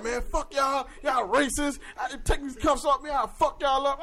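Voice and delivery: imitating a hostile arrestee, high-pitched; hammed-up defiant cursing at the cops; mock angry yelling. Arrested friend cursing out officers, imitated angry yelling. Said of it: "screaming, yelling, cursing them out".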